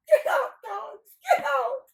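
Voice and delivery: very high, loud yelling; hammed-up high-pitched shout; squealed command. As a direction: shriek it heedlessly at everyone. Nasrudin yells at the storyteller.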